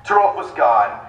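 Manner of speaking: deadpan romance audiobook reading; dramatic reading. Acted. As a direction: finish the story with a straight face. Second reader continues the novel.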